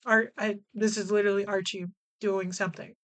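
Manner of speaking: normal talking voice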